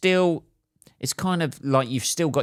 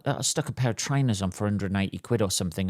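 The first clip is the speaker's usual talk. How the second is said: ordinary talk, nothing marked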